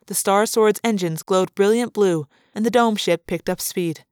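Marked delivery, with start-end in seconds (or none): none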